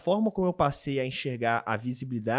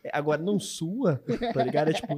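ordinary talk

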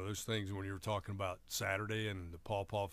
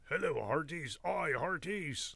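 pirate voice; 6 semitones higher than usual. Retold or imitated. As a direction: hail your crew like an old pirate. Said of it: "you're better at this than I expected".

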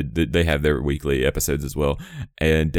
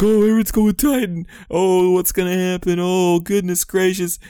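high, loud excited fan voices; squealing crowd imitation; mock hype crowd; 3 dB louder than usual. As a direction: gush like every fan at once. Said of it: "Everybody's like".

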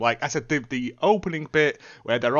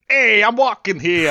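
louder, higher cartoon New Yorker; cartoon New York accent; 6 semitones higher, 4 dB louder than usual. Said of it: "like, cartoon level".

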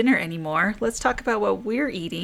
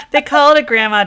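laughing; 7 semitones higher, 10 dB louder than usual. Real, not acted. Laughing while telling coworkers' teasing.

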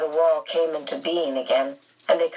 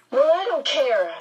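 defiant; 6 semitones higher than usual. Acted. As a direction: snap back in defiance. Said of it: "Meg said defiantly".

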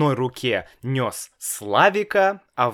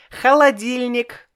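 put-on high little boy's voice, much higher; child voice; 13 semitones higher, 5 dB louder than usual. A narrator's reading. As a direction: play a small boy, innocent. Child answers happily.

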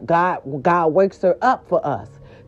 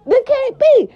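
louder disbelief; 5 dB louder than usual. Real, not acted. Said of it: "And that can't be".

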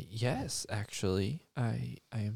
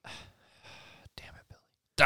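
muttered curse; much slower than usual. Acted. Mom cursing under breath.

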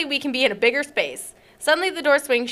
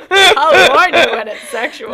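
slow, louder, laughing through it; laughing mock quote; 3 semitones higher, 9 dB louder, slower than usual. Echoing the cohost's warning, laughing. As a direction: repeat his line while cracking up.

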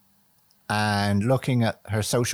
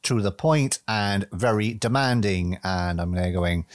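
ordinary delivery

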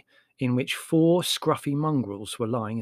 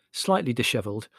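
plain reading voice

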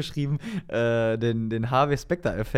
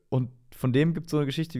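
ordinary talk, nothing marked

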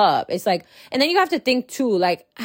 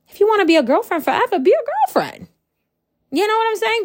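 much higher, quick and bouncy; sing-song encouragement; 13 semitones higher, faster than usual. Acted. Telling listeners to stay girlfriends forever, high and breezy. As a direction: lift it up, breezy and teasing.

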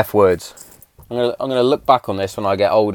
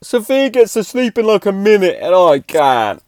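put-on high, loud whining little girl; high whiny child impression; whiny daughter; 10 semitones higher, 5 dB louder than usual. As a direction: mimic a sulky child complaining. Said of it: "She's like".

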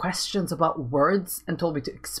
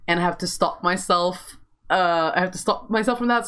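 normal talking voice

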